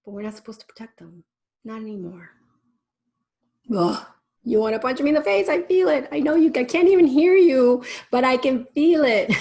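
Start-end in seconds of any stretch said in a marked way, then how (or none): 3.7-9.3 playful teasing. Real, not acted. Teasing audience's imagined anger.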